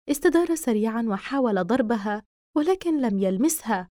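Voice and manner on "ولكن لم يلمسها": usual speaking voice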